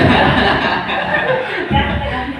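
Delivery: high, loud burst of real laughter; hearty laugh. Real, not acted. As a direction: laugh out loud at your own joke. Joking with audience about wives.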